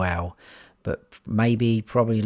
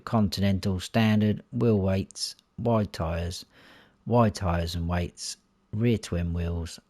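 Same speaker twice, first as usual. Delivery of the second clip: ordinary talk, nothing marked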